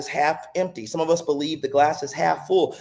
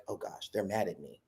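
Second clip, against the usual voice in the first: hushed, worried aside to yourself; anxious inner voice; 6 semitones lower, 15 dB quieter than usual. Retold or imitated. A coworker fearing they're in trouble, hushed and worried. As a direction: mutter the panicked thought under your breath. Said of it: "you're like".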